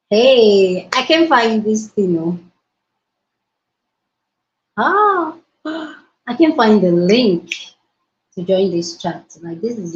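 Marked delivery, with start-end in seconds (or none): none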